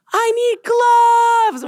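put-on very high, loud, slow toddler wail; whiny child. Parent mimics son wanting gloves, whiny child. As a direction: stretch it out like a whining three-year-old. Three-year-old demanding, acted by parent.

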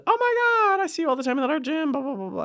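high, gushing mock of an eager man; imitating eager guy. Friend mimics gym guy who approached her, high and gushing. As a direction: squeal it like the overexcited gym guy. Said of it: "A gentleman came up to me and said".

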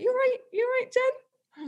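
high mock-concerned check-in; concerned colleague. Colleague on the run asks if she is okay, high mock concern. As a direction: imitate the puzzled colleague asking.

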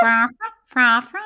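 loud vocal horn toots; imitated horn. Host closing the segment, imitated horn. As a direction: blare like a horn, badly. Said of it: "losing my horn skill".

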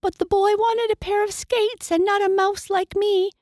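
very high, squeaky storytelling; rolling mouse. A narrator's reading. Mouse explains how she rolled away, squeaky and chatty. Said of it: "said the rolling mouse".

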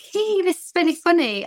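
high, bright "Hey, this is really funny"; forced cheerful mum. Pretend. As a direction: sound upbeat to laugh along with a prank. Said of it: "I have to be like".

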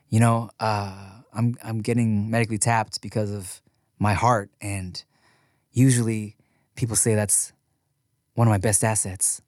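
halting, low, heavy-hearted confession to camera; sad retelling. Retold or imitated. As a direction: act a mock-solemn farewell with long pauses. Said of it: "he's sad, his team is sad".

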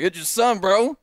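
high, loud, cocky jerk. Retold or imitated. Son phoning estranged dad like old friends, jerky and brash. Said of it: "I said it like that, like a, like a jerk".

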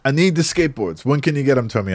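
imitated insistent old shopkeeper; pushy shop owner. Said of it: "this is Tony".

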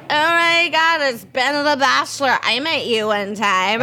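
high, gushing mock-stoned excitement, slowing at the end; imitating being drugged-up. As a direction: act out a woozy fan high on painkillers. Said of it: "essentially just high off my ass".

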